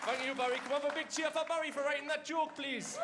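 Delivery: high, loud crowd-rousing shout; rousing call for applause. Real, not acted. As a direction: whip the audience into cheering. Calls for a big audience cheer.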